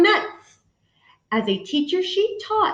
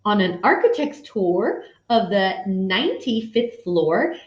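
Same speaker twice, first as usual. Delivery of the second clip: usual speaking voice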